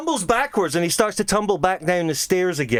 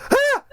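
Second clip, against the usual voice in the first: short, high exclaimed "ah"; sudden high-pitched cry of a man heard offstage; quick acted yelp; imitated yelp; 4 dB louder than usual. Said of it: "you can just hear a".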